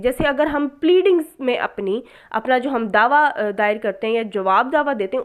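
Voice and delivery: ordinary delivery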